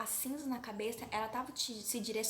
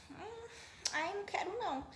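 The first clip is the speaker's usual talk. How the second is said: coy refusal; 9 semitones higher than usual. Acted. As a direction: turn it down like a spoiled brat. Aminon recusa a comida, dengoso. (recusa manhosa, aguda e baixinha)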